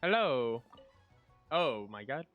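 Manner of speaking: usual speaking voice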